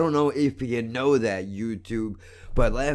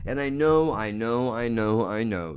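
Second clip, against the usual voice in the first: usual speaking voice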